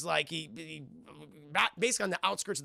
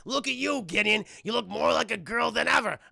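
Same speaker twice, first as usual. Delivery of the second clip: jeering; 5 semitones higher, 5 dB louder than usual. Retold or imitated. Acted jeer at Gideon.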